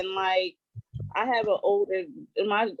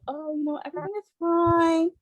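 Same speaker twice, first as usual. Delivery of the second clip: bright, higher put-on "everything is fine"; fake cheerful mask; 8 semitones higher than usual. Mother showing her kids a breezy front, put-on cheer. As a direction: cover the worry with a breezy front. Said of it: "I wear this mask of".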